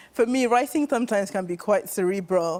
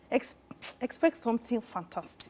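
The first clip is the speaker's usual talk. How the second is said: Nigerian accent; 9 dB quieter than usual. Real, not acted. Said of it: "I like the way you switched to your Nigerian accent".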